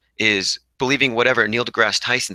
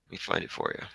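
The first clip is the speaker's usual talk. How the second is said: whispered to himself while searching; whispered aside; 14 dB quieter than usual. Real, not acted. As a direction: murmur it under your breath, almost voiceless.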